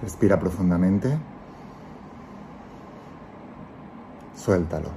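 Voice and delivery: calm guiding voice, let each phrase settle; guided meditation. Acted. Leads a guided visualization.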